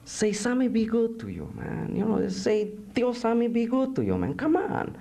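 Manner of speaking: high, wheedling barrio voice; pleading sidekick. Acted. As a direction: coax the prisoner in a high voice. Coaxing figure in poem.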